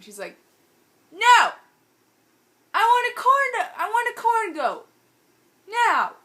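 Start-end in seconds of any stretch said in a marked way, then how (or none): 1.1-6.1 very high, very loud imitated tantrum; tantrum yell. Friend demanding a corn goat, mock tantrum yell. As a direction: bellow like a child refusing to budge.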